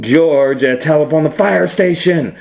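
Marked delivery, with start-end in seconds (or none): none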